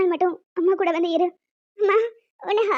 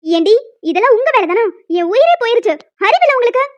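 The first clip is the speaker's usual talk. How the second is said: angry scolding; 2 semitones higher, 9 dB louder than usual. Acted. Warden telling off pranksters who scared her, angry. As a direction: scold them loudly after a fright.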